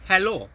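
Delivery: helo with a short open e, said clearly; teacher modelling a Welsh greeting. Shown as a demonstration. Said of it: "that's how we say hello".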